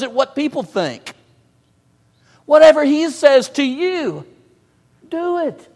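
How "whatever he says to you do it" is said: Whatever he says to you, loud and high each time; emphatic repetition. Shown as a demonstration. Preacher repeating the verse to press the word, loud and high. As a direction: hammer home Whatever. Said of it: "The emphasis".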